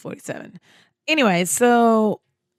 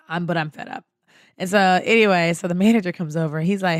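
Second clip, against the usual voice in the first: ordinary talk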